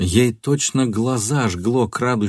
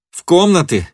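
high, loud cry, beside herself; enraged shout; 9 semitones higher, 6 dB louder than usual. A narrator's reading. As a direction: throw the words back in fury. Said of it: "крикнула Фенемора вне себя".

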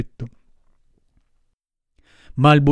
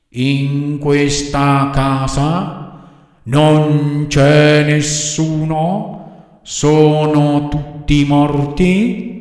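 very slow, droning ghostly monotone; otherworldly little voice; 6 dB louder, much slower than usual. A narrator's reading. Dead girl answers Pinocchio from the window, otherworldly voice. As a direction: intone it endlessly slowly, as from the other world. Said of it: "una vocina che pareva venisse dall'altro mondo".

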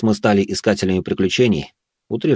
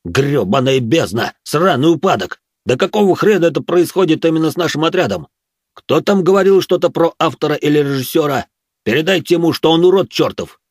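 loud, higher furious dwarf rant; roaring grunting brute; 7 semitones higher, 4 dB louder than usual. Acted. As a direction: curse the sky like an enraged dwarf.

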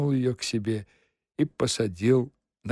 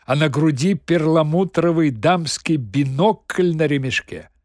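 ordinary delivery, nothing marked

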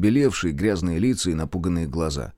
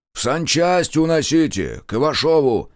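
shouted order; 7 semitones higher, 4 dB louder than usual. A narrator's reading. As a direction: shout to carry the wounded off. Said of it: "крикнул Марков".

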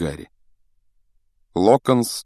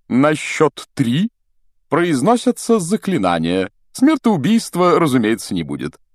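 showy instructing; 8 semitones higher, 4 dB louder than usual. A narrator's reading. Pompous teacher explaining duel rules to pupils, showy.

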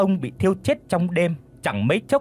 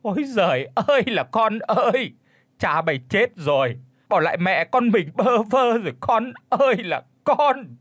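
heartrending wailing; 7 semitones higher than usual. A narrator's reading. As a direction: wail the bad news to your child. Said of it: "gào lên thống thiết".

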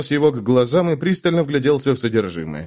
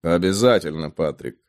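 low gruff man; 7 semitones lower than usual. Acted. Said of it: "перебил его Велфорд".

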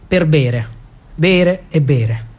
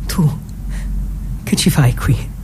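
whisper; 5 semitones lower, 5 dB quieter than usual. Acted. Surprised to find him there.

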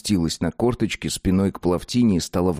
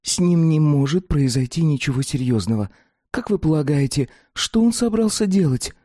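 put-on young woman voice; 7 semitones higher than usual. Plavtina voiced in high register.

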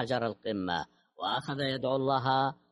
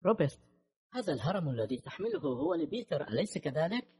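trembling voice; 5 semitones higher than usual. A narrator's reading. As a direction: answer as if shaken, pitched up. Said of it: "أجابته بصوت مرتجف". (صوت مرتجف)

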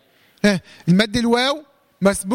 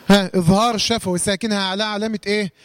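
ordinary talk

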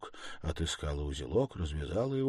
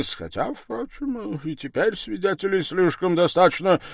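halting, raised-voice backtracking; flustered accuser; 8 semitones higher, 12 dB louder than usual. Acted. Accuser hedging about searching the girl, flustered and halting. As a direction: hesitate and start over, uneven pitch.

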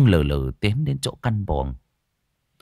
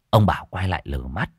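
ordinary delivery

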